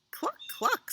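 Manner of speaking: very high, short double cluck; two squeaky-high hen clucks; clucking cow. Acted. Cow greets Duck in a story, high clucking. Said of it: "said Cow".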